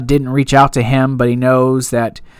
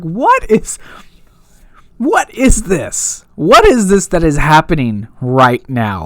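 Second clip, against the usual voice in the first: mock disbelief; 3 semitones higher than usual. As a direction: act out baffled disbelief at what you watched. Reacting in disbelief to the episode.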